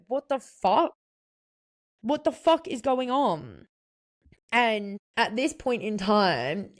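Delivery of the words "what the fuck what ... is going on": higher, exasperated outburst; upset disbelief. Retold or imitated. Retelling her shock at the boyfriend's yelling, higher exasperated outburst. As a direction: blurt it out, baffled and hurt. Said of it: "I was so upset".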